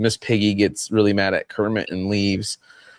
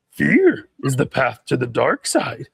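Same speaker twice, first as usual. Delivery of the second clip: lightly voiced wise old Jedi master; a hint of Yoda's growl; 4 semitones higher than usual. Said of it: "a little bit of Yoda".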